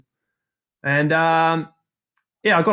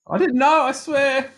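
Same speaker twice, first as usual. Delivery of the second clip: higher, pleading innocence; pleading protest; 12 semitones higher, slower than usual. Real, not acted. Accused teammate pleading innocence to the judge. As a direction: protest your innocence, half laughing. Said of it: "Oh, [name]".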